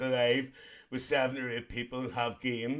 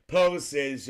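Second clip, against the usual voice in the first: ordinary delivery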